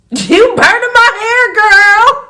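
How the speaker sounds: high, slow, loud yell; screamed quote. Retold or imitated. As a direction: scream it at the girl. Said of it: "Like, screamed it".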